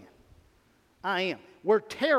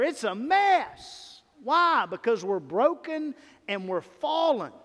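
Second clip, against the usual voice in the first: preaching outburst; 4 semitones higher, slower than usual. Real, not acted. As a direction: thunder it from the pulpit, then demand why. Preacher rails at church troubles.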